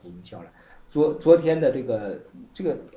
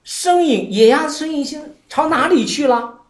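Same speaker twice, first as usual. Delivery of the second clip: master's probing question; 8 semitones higher, 4 dB louder than usual. Retold or imitated. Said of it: "但是马祖就说".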